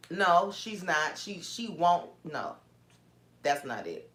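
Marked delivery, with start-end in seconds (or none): none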